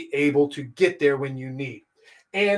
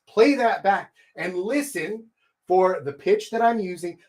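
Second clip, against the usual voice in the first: ordinary talk, nothing marked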